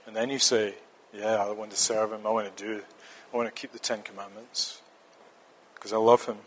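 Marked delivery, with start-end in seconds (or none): 1.1-6.4 hushed, sincere pledge of love; quiet heartfelt promise to God; soft devoted voice. Pretend. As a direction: murmur it like a private vow. Imagined believer's quiet devotion.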